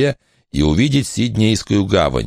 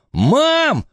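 put-on boy's shout. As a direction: yell for help. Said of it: "закричал Барнаби".

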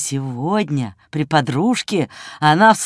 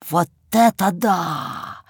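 медленно, протяжно изумиться; протянуть слова с тихим потрясением; изумлённая мысль; 3 dB quieter, slower than usual. A narrator's reading. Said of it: "подумал Жорик и вдруг ощутил страшное волнение". (изумлённо, врастяжку)

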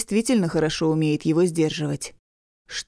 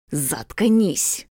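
hissed; 3 semitones lower than usual. Acted. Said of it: "шипит он".

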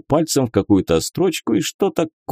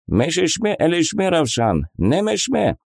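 gibberish migrant voice; 7 semitones higher than usual. Acted. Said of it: "Jamshut character gibberish".

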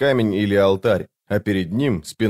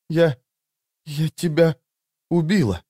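put-on high, halting girl's voice; halting words with pauses, pitched high in horror; horrified realisation; 6 semitones higher than usual. A narrator's reading. Horrified girl realises she killed him.

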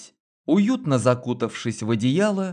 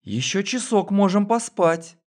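languid coo; 7 semitones higher than usual. A narrator's reading. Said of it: "томно проворковала Лена".

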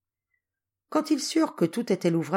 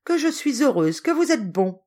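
higher-pitched happy exclamation; joyful cry; 6 semitones higher, 4 dB louder than usual. A narrator's reading. Niece thanking her uncle, joyful exclamation. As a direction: exclaim it, brimming with gratitude. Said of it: "s'écria-t-elle".

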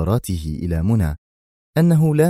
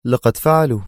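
slightly raised, embittered answer; bitter reply; 5 semitones higher, 4 dB louder than usual. A narrator's reading. He confirms his punishment, mildly bitter. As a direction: let bitterness show through forced calm. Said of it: "جاءت مفعمة بالمرارة وهو يجيب".

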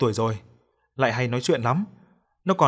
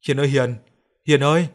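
raised voice, hailing a neighbour; calling out loudly; 5 dB louder than usual. A narrator's reading. Bà Liên at the neighbour Hiền's house, calling out loudly. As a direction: call out to someone inside the house. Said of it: "bà gọi to".

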